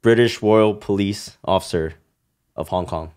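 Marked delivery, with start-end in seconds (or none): none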